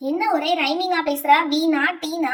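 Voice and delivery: ordinary talk, nothing marked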